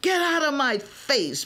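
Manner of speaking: understated angry yell. A narrator's reading. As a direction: snap at the defender, lightly voiced. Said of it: "Brandy yells".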